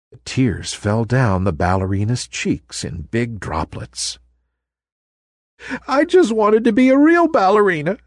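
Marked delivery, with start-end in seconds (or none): 5.9-8.0 high, teary sniffling plea. Acted. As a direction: hold back tears. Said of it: "she sniffed".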